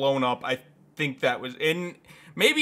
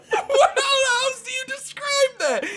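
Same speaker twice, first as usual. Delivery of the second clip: squeaky, laughing protest; high laughing defense; 8 dB louder than usual. Real, not acted. Defending the joke to a friend, laughing hard. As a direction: plead through giggles.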